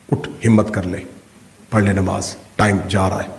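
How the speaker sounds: low, quiet urging from within; subdued inner prodding. Acted. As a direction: coax softly in a low voice. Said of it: "پھر اندر سے آواز آئی".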